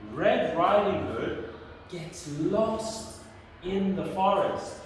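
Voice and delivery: usual speaking voice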